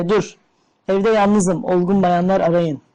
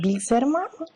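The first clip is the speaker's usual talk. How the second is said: soft, quiet put-on woman's voice; man playing a woman softly on the phone; man doing a woman's voice; 5 semitones higher, 5 dB quieter than usual. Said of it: "Oğlum ben kadın sesi yapamam ki".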